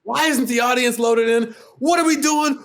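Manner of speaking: pissed-off boss yelling, pitched very high; retold furious high-pitched scolding; angry screaming. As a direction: shout impatient orders, high and exasperated. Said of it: "my shooter is pissed ... he's screaming at me".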